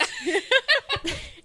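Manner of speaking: high burst of laughter. Real, not acted. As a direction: squeal with laughter. Laughs at the joke.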